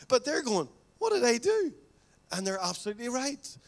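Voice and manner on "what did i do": high, loud startled question; baffled protest. Retold or imitated. Accused friend protesting innocently, much higher and louder. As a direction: jump way up in pitch like someone caught off guard. The other person's baffled reply.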